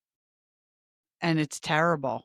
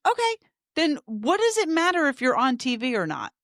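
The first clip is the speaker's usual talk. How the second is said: raised, high-pitched insistence; heated arguing; 9 semitones higher, 5 dB louder than usual. Real, not acted. Co-host argues cursing on TV is just talk, heated and loud. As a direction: push back loudly, climbing to the last phrase.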